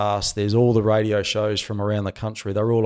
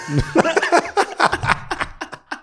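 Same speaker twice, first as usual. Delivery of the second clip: big laugh. Real, not acted. Laughing after the punchline.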